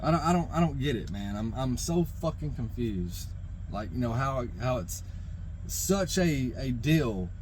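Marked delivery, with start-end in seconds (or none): none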